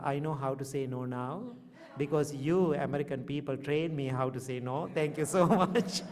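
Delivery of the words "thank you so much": higher, louder, laughing mid-phrase; laughing thanks. Real, not acted. Thanking American people for teaching him no, laughing. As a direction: tease them with a laughing thank you.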